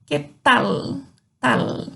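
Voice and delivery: "tal" with a held, clear l; Spanish final L. Shown as a demonstration. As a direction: end "Tal" with tongue on the palate. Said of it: "ele termina com L".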